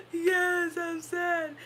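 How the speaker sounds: gasp, then loud high wail; imitated sad child. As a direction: mimic a small boy bawling. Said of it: "he was just so sad".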